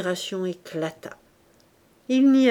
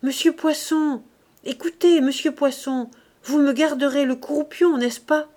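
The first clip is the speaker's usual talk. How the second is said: higher, pressing, insistent plea over the noise; 6 semitones higher, 4 dB louder than usual. Acted. Clémence insisting to Poisson amid the din, higher insistent call. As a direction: keep pressing him to save her the rump. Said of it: "avec insistance".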